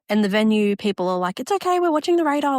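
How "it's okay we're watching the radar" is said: reassuring staff. Retold or imitated. Venue staff reassuring the bride.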